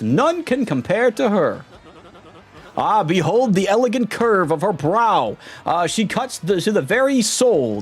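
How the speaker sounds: high, pompous ship captain voice, stretched vowels; pompous captain Flavio. Acted. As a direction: show off the ship like a vain aristocrat.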